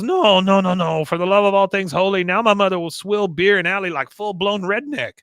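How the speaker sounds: hammed-up outrage in accented English; appalled Con. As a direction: react in horror at the window.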